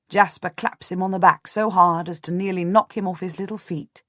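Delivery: plain reading voice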